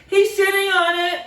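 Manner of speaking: put-on tattling kid. Classmate tattling on Ralph, high and loud. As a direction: tell on him gleefully.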